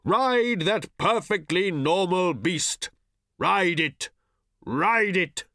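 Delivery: slow, high, long-drawn call; distant shout. A narrator's reading. Old man calling after departing riders, distant shout. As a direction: holler it after riders far away. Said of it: "His distant voice reverberated faintly".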